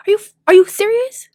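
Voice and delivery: high, fast disbelief; can't believe it; incredulous. Real, not acted. Outraged disbelief.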